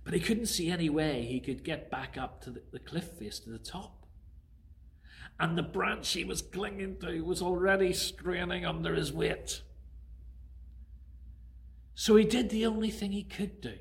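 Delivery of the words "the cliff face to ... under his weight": ordinary delivery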